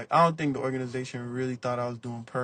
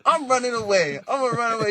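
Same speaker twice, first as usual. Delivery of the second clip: put-on high, pouty child voice threatening to leave; be a mad little kid storming off; sulky kid threat; 8 semitones higher, 3 dB louder than usual. Kid mad at his mom, high pouty threat to run away. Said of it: "You know how kids be when their mom make 'em mad?".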